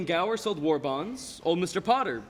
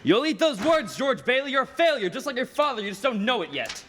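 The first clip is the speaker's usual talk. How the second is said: angry threat; 8 semitones higher, 4 dB louder than usual. Acted.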